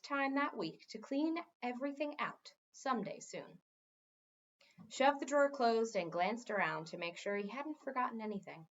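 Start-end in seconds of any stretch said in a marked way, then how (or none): none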